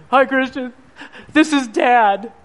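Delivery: mock-tearful greeting, slowing at the end; retold tearful father. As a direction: imitate a man phoning through tears. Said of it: "he's crying".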